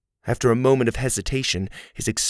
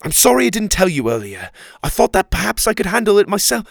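high, loud rush of apology; flustered, tumbling explanation; hurried; 10 semitones higher, 6 dB louder, faster than usual. A narrator's reading. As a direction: spit the words out in a hurry. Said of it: "spat out his words in a hurry".

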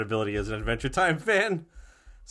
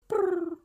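high trilled brr for a bell; makeshift ding noise. Shown as a demonstration. Contestant supplying his own quiz ding, makeshift trilled noise. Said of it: "I guess that's a ding".

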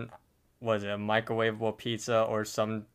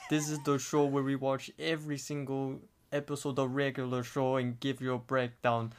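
put-on softer, deliberate Russian-accented show intro; host the show in a Russian accent; 3 dB quieter than usual. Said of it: "in a Russian accent".

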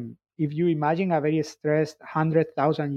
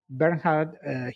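ordinary delivery

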